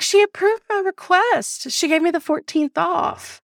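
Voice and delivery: high-pitched gleeful mimicry; elated. Coworker announcing his request got approved, elated. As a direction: imitate someone triumphant, pitched well up. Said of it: "this bitch comes back elated".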